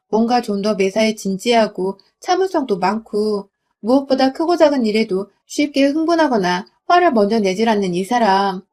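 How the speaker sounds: usual speaking voice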